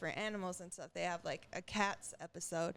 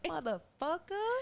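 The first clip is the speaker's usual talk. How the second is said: imitating a toddler's cuss, higher and louder with a rising end; animated little kid; 9 semitones higher, 4 dB louder than usual. Mom imitating her son cursing, a hammed-up kid voice. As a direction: do the kid proudly swearing. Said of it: "like, animated".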